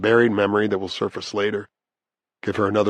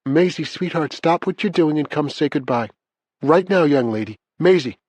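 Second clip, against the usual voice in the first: higher, insistent calling voice; grandmother calling a child; 6 semitones higher than usual. A narrator's reading. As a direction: summon a dawdling child, pitched up. Grandmother calls child to the phone.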